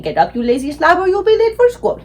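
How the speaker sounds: scolding dad, pitch climbing to a high finish; exasperated father's wake-up call; father's real voice. Acted. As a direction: rouse a lazy child, rising sharply at the end. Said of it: "my father's real voice saying".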